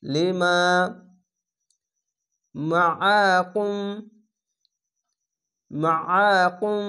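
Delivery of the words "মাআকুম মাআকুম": slow drawn-out Quranic recitation of মাআকুম with heavy throaty আইন; মাআকুম with the আইন made thick and throat-pressed. Shown as a demonstration. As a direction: chant মাআকুম slowly, squeezing the throat on the আইন. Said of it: "আইনটিকে মোটা করে গলা চিপে পড়বেন".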